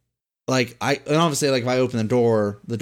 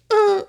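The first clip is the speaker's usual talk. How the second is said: ghost noise; 5 dB louder than usual. Pretend. Faking ghost noise to haunt.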